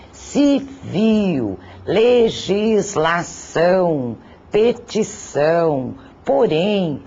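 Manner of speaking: each word syllabified, ci-VIL, legisla-ÇÃO, peti-ÇÃO, po-RÉM; slow word list stressing each final syllable; stress on last syllables. Shown as a demonstration. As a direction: draw out "civil, legislação, petição, porém", leaning on final syllables. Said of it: "sílaba tônica recai na última".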